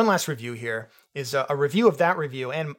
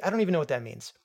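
usual speaking voice

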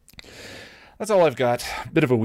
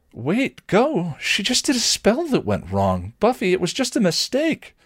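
high-pitched, urgent pleading; begging; 13 semitones higher than usual. A narrator's reading. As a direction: plead desperately to stop her leaving. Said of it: "Willow begged".

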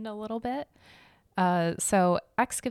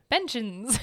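"benches." said through a laugh, a bit higher; 4 semitones higher than usual. Shown as a demonstration. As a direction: giggle while saying "benches.". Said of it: "So this was literally a series of benches".

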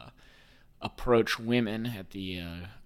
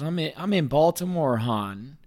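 a touch of Baltimore accent; lightly put-on Baltimore talk; 5 semitones higher, 6 dB louder than usual. Shown as a demonstration. Host explaining his Joe Burrows to listeners, Baltimore accent. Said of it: "that's how they talk out here".

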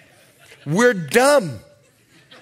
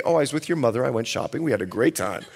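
carefree wife; 9 semitones lower, 5 dB quieter, much faster than usual. Retold or imitated. Wife's carefree reply quoted.

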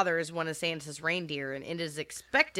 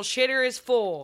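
louder, slower repeat of the line; slow movie quote; 5 semitones higher, 5 dB louder, slower than usual. Retold or imitated. Repeating the quote for the co-host, slow and loud. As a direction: draw it out, savoring the quote.

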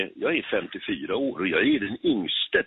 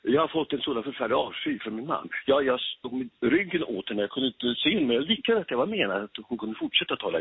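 higher-pitched theatrical delivery of lines; worked-up stage recitation; 5 semitones higher than usual. Shown as a demonstration. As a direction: recite as an actor with the voice lifted. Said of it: "så tar jag den här texten". (teatral replik med uppjobbad röst)

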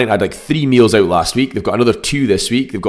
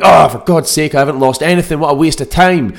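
exasperated, higher and louder; frustrated moaning; 7 semitones higher, 4 dB louder than usual. Retold or imitated. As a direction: moan like a fed-up dieter. Voicing frustrated dieters.

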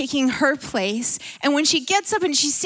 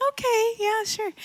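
a higher, sweet Southern-lady okay; Southern hostess; 8 semitones higher, 4 dB quieter than usual. Retold or imitated. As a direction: lightly play the agreeable Southern hostess. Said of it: "she's like a Southern woman".

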